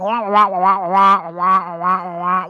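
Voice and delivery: muffled teacher-style wah wah drone; Willy Wonka wah-wah. Retold or imitated. Tuning out the boss, droning wah wah. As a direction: drone like adult talk in a cartoon. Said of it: "you hear that Willy Wonka effect".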